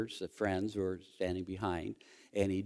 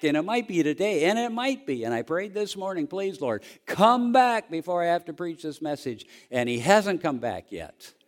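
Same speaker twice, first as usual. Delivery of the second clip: higher and louder, pushing toward a climax; fiery preaching; 7 semitones higher, 6 dB louder than usual. Acted. Pastor preaching hope to congregation, rising fiery delivery. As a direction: preach it full force, getting more excited. Preaching builds to full force.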